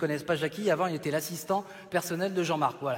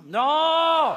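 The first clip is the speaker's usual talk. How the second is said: long, very high loud cry; outraged refusal; 11 semitones higher, 9 dB louder than usual. Acted. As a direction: shout it down, stretched out. Forbids the offensive word.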